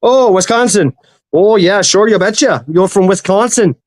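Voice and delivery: lightly put-on Minnesota-style twang; hamming up a Midwestern folksy accent; Upper Midwest accent. Joking about a Wisconsin listener.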